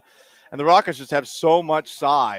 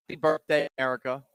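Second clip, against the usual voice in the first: normal talking voice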